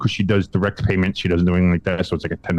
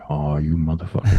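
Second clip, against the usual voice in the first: low muttered disgust; low muttered curse; 7 semitones lower than usual. Retold or imitated. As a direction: grumble it under your breath. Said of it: "I'm like".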